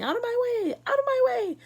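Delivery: put-on very high, loud old woman's screech; shouting. A narrator's reading. Grandma Georgina screeching while crashing round the elevator. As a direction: screech it as she barrels through.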